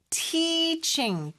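"teaching" with a long, high drawn-out tee; "teaching" as teee-ching, held long; lengthened vowel. Shown as a demonstration. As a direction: stretch the first syllable of "teaching". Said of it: "T 길게 끌어야 되겠죠?".

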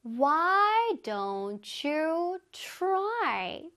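very slow, word by word; slow repeat-after-me model. Shown as a demonstration. Teacher leads students in repeating the pattern, very slowly. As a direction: stretch out each word for learners to follow. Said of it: "이거 한번 따라해보자".

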